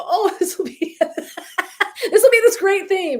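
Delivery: high, laughing, over-bright delight; mock excitement. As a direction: gush about the idea, laughing at yourself. Mimicking her own excited start.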